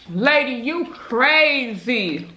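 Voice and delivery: drawn out, slow and stunned; slow disbelief. Retold or imitated. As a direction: let it out slowly in disbelief. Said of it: "I was like".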